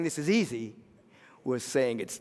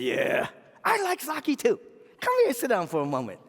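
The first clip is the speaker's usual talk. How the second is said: small man's voice; 9 semitones higher than usual. Acted. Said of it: "this small Japanese guy".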